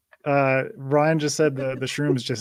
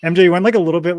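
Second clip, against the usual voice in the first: ordinary talk, nothing marked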